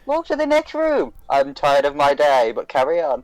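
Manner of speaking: weary theme-park announcer. Retold or imitated. Player imitates theme park staff on the tannoy, weary announcer voice. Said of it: "It's like at the theme park".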